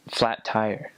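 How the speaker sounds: ordinary delivery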